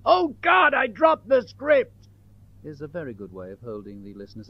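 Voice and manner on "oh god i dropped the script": high, loud cry of dismay; shrieked mishap, drawn out; panicked shout. Acted. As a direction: yell it out in sudden alarm. Mock panic interrupts the calm pitch.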